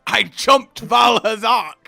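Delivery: loud, high, drawn-out exclamation; excited shout. Real, not acted. Telling the guys about jumping Val Hazak, excited shout. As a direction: shout it out slowly to the whole group.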